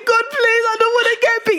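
put-on high, pleading child's prayer; mock desperate prayer. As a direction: beg God like a terrified kid. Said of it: "You're now praying to God".

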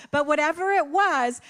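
usual speaking voice

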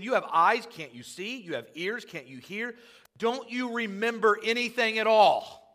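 high, exasperated rebuke building to loud; frustrated Jesus. Acted. Jesus berating his forgetful disciples, frustrated. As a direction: scold in rising disbelief, peaking on the last question. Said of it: "He's getting a little frustrated".

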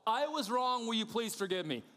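high, emphatic apology; owning it. Acted. People who own their fault ask forgiveness, loud and emphatic. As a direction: declare the fault loudly and squarely. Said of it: "men and women who go".

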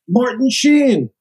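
high, loud, drawn-out yell; screaming his name. Retold or imitated. Mom spotting a celebrity in the airport, loud drawn-out scream. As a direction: shout the name across the terminal, slow and loud. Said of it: "screams out".

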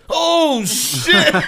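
very high, loud shriek of shock; horrified mock scream. Roommate arrives and sees the injury, horrified shriek. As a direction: yell it way up high, comically appalled.

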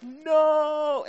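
high, loud cry of horror; hammed-up horrified wail, held long; retold horror. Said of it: "I'm in horror".

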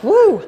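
high, sudden whoop; loud woo. Real, not acted. Preacher breaking off her tangent, high loud woo. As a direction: belt out a quick "woo". Exclaims after tangent about children.

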